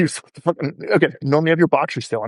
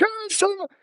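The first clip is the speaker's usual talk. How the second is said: 1 exasperated laugh; 5 dB quieter than usual. Retold or imitated. Client laughing, caught out.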